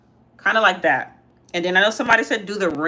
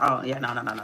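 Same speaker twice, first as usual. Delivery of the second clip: put-on lower, softer little-boy voice, hurried at the end; imitating son's low tone; 6 semitones lower, 5 dB quieter, faster than usual. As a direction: mimic a sheepish kid brushing it off. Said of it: "he's talking in a low tone".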